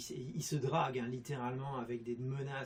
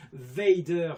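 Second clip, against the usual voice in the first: loud; 10 dB louder than usual. Retold or imitated. Remembering bully's name.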